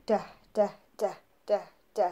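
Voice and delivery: "D" said as short, separate, voiced d taps; repeated "D" said crisply like a drum; drum-beat d sounds. Shown as a demonstration. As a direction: tap out "D" like a drumbeat, one sound at a time. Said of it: "we do this".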